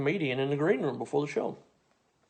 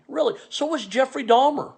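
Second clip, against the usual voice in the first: high, mock-impressed then cutting; sarcastic comeback; 10 semitones higher than usual. Answering the Gene Wilder boast, high sarcastic jab. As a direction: feign interest, then drop the zinger.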